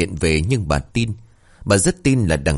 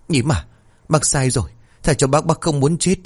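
higher, pleading, mildly frightened; begging in fear; 7 semitones higher than usual. A narrator's reading. Woman pleading with the child's ghost, mildly frightened plea. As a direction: beg for your life.

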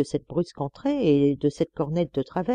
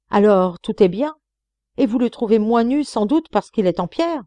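aggressive hiss; 5 semitones higher, 5 dB louder than usual. Acted. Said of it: "Sa voix siffla entre ses lèvres".

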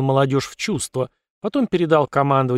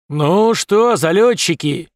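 loud drill-sergeant bark; barking command voice; 4 semitones higher, 5 dB louder, much slower than usual. Acted. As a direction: bark it like a general. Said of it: "рявкнул Михеев отлично поставленным командным голосом".

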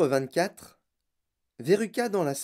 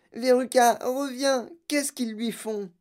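alarmed cry; 10 semitones higher, 3 dB louder, slower than usual. A narrator's reading. Mother watching squirrels pin her daughter, alarmed. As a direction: call out high in alarm. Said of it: "hurla madame Salt".

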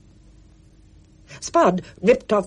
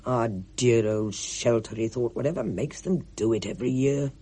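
gloomy Mister Ackley; 4 semitones lower, 6 dB quieter than usual. A narrator's reading. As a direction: let the pessimism ooze. Ackley's thought voiced.

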